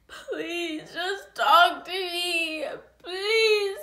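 high, whiny, drawn-out begging; mock pleading. Ex begging her to talk, imitated whining plea. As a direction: imitate a tearful plea, stretching the words. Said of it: "like, he could just say".

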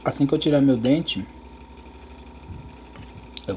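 talking with food in mouth; muffled chewing speech; chewing while talking. Real, not acted. Seen 2: Lips pursed, cheeks working on food early; mouth clearer later.

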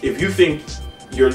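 ordinary talk, nothing marked

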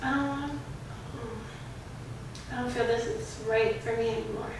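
very soft, halting pretend heartache; feigned reluctance. Girlfriend fakes a breakup with boyfriend, quiet reluctance. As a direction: hesitate quietly, as if it hurts to say.

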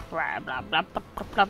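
low, soft mocking babble; mock droning speech. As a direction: mimic a speaker droning on under your breath. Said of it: "I'm just listening to him speak".

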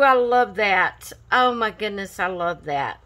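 usual speaking voice

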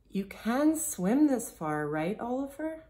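worried question. A narrator's reading. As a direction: seek reassurance from a friend. Girl anxiously asks about long swim.

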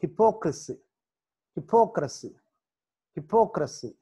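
Hypocrisy with the stress on "po"; hypocrisy, "po" stressed, then falling. Shown as a demonstration. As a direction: hit "po" in hypocrisy. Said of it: "పి దగ్గర stress mark".

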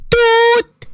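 sharp high toot like a party horn; toy horn toot. A narrator's reading. Sister tooting a horn in her brother's ear, toot. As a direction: blast a horn noise into his ear. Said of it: "اللي بتزمر في ودانه".

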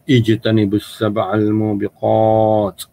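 ijtanibus sab'al mubiqat slow with full Arabic intonation. Shown as a demonstration. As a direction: chant it slowly in a true Arabic lilt. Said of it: "baca intonasi yang baik".

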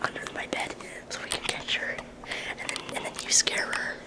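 conspiratorial whisper; whispering while hiding. Real, not acted. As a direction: whisper the plan, sneaky. Plotting a scare, hiding.

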